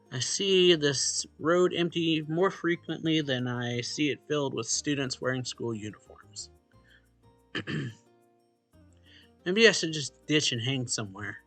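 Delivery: ordinary talk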